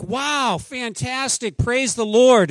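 high, loud burst of joy; beaming pastor cheering the news; delighted praise. Retold or imitated. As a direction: gush with happy surprise. Pastor's delighted reaction retold.

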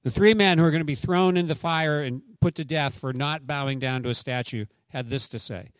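ordinary talk, nothing marked

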